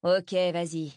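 ordinary delivery, nothing marked

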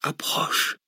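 whispered and faint, nearly gone; breathed whisper. A narrator's reading. Dying prisoner whispers to Cortès again, breathy whisper. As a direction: breathe the word out. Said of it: "souffla encore Parastou".

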